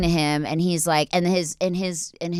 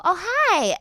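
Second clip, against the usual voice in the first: put-on higher, sweet girlish greeting; light girly hello, higher; naive teen girl; 12 semitones higher than usual. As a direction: chirp it like an innocent teen. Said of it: "I go".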